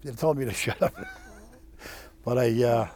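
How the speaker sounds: ordinary talk, nothing marked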